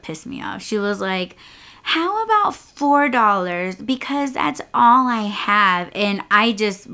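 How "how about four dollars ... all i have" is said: high, drawn-out mimicry of the rider; mocking imitation. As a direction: stretch it out high, mocking her excuse. Mimics rider haggling over fare.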